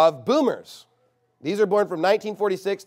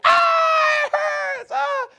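very high, loud mock childish yelp of pain; child's cry; 7 dB louder, much slower than usual. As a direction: squeal it like a burned little kid, hammed-up. Child burned by fire.

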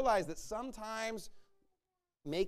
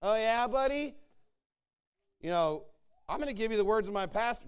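mock snarky comeback; 3 semitones higher, 5 dB louder than usual. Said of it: "a temptation to wanna be like".

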